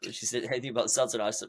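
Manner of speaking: usual speaking voice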